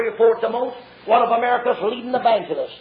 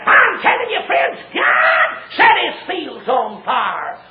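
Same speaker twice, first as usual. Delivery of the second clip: high, driving revival holler; urgent pulpit cry, breaking into shouts; full-force preaching; 4 semitones higher, 4 dB louder than usual. Real, not acted. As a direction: build to a shouted denunciation.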